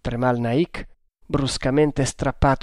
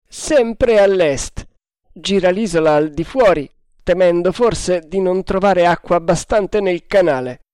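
raised, louder voice; called-out report; 5 semitones higher, 5 dB louder than usual. A narrator's reading. Quartermaster reporting the frigate's route, calling out. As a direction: project the report firmly.

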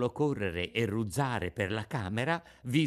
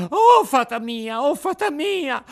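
very high, loud panicked squeal; shrieking puppet; 12 dB louder than usual. A narrator's reading. Puppet calling the Fairy for rescue, panicked shriek. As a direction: squeal it out in terror. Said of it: "cominciò allora a strillare il burattino".

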